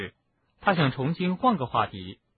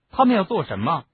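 louder, higher impatient question; narrated boy's sharp, impatient question; 6 semitones higher, 5 dB louder than usual. A narrator's reading. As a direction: demand it impatiently. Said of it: "哈利不耐烦地问".

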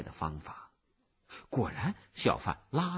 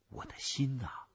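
plain reading voice

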